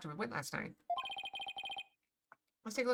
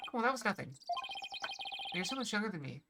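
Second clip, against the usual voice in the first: usual speaking voice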